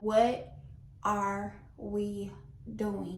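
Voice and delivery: WHAT, are we, DOING broken by pauses; exasperated word-by-word. Retold or imitated. Acted exasperated question, word by word.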